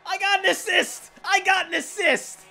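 very high, loud cheering; ecstatic shout. Real, not acted. Gamer celebrating his first assist, ecstatic shout. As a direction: scream with joy at the assist.

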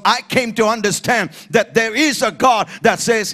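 loud, driving testimony from the pulpit; preaching at full force. Real, not acted. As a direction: build the testimony with fervour.

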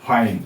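ফাইন with long "eye" vowel, ending on n; silent final e. Shown as a demonstration. Teacher showing learners the silent e, ফাইন clearly pronounced, ending on n. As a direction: say ফাইন as one syllable, no final e. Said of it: "ই উচ্চারণ হবে না".

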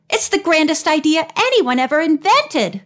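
high, gushing enthusiasm; excited cry. A narrator's reading. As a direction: cry it out with delight. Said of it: "she cried".

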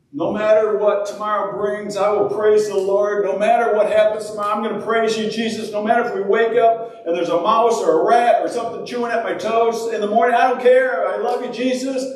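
high, driving declaration of praise; acted unshakable devotion; fervent praise. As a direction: declare it undaunted, pitch held up high. Imagined defiant praise in prison.

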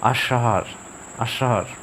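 আষাঢ় with a drawn h sound at the end; falling, separate আষাঢ় with a breathy h release; aspirated final R. Shown as a demonstration. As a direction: let the last sound of আষাঢ় trail off in air. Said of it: "হয়ের একটু টান আসবে".

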